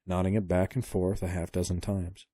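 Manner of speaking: usual speaking voice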